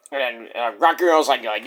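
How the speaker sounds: usual speaking voice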